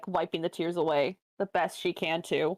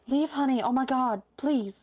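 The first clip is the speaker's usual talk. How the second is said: higher, anxious, begging her to respond; worried pleading; 6 semitones higher than usual. Acted. Saheera kneeling beside sobbing Leaf, worried and pleading. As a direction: plead with a frightened friend.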